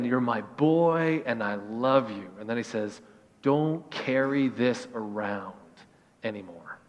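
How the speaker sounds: slow, soft comfort that fades away; tender father. Retold or imitated. Pastor retelling a father hugging his son, slow and tender. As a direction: hold him close and say it gently.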